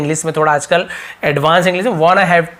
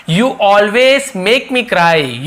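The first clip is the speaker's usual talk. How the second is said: stretched out, a little raised; slow model sentence; 2 semitones higher, much slower than usual. Shown as a demonstration. Teacher slowly modelling an English sentence for class. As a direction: spell it out for learners.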